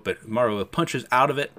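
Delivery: plain reading voice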